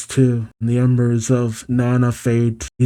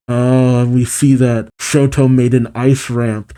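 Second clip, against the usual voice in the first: usual speaking voice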